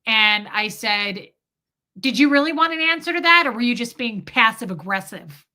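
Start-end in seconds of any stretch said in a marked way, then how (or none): 2.0-5.4 put-on bitchy comeback in a Brooklyn accent; switched-up Brooklyn accent. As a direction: hit back in pushy mock Brooklyn, easing off. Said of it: "I switched up my accent just to be a bitch back to him".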